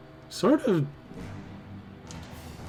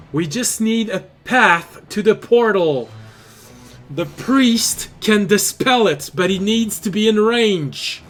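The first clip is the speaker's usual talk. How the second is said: higher, louder urgent call; game master voicing the rival, raised urgent voice; rival's character voice; 8 semitones higher, 6 dB louder than usual. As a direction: project it up high like orders in battle. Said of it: "she tells you".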